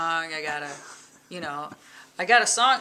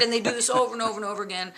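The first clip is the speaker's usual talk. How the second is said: normal talking voice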